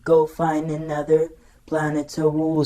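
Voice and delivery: robot dismissing a rival; Soundwave impression. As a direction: order him off the planet. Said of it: "grabbed Steeljaw and said".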